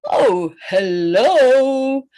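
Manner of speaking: slow, drawn-out weary greeting; tired farmer. Pretend. Tired farmer answering the kids' hello, slow and weary. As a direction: drag it out like a worn-out old farmer.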